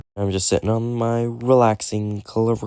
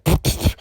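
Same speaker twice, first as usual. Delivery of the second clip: breathy spitting puffs; spitting out sand. Retold or imitated. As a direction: spit sand out, pff pff. Sand kicked in his face.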